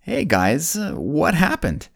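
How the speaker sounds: lightly voiced God, high and easygoing; a casual, friendly God checking in. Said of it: "God comes to Adam and Eve and basically says".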